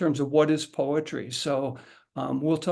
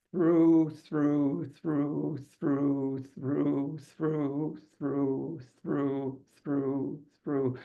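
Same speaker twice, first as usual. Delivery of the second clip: one breath, fading out; running out of breath; much slower than usual. Shown as a demonstration. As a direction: say it all on one breath till air runs out. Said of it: "We're gonna do that now".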